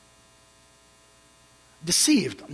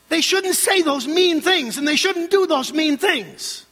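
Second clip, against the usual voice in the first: higher-pitched indignant objector's voice; objecting congregant; 7 semitones higher, 3 dB louder than usual. Acted. Congregant protesting to the pastor about mean people, indignant. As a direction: mimic a whining complaint to the pastor. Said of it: "Some of you are thinking".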